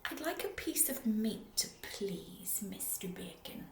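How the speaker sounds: soft whisper; very quietly. Acted. Mister Noisy politely whispering to the butcher. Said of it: "very quietly, he said".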